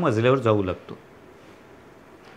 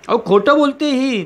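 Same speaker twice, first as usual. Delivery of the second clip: angry shout; 7 semitones higher than usual. Acted. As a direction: call her a liar at the top of your voice. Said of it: "तुम्ही ओरडता". (उंच, मोठ्याने संतापलेली आरोळी)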